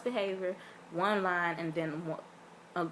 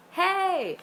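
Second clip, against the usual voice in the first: high, louder greeting; imitated reply; 11 semitones higher, 6 dB louder than usual. [name] calling back to the fan, loud. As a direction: throw back a bright, loud hey. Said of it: "he was like".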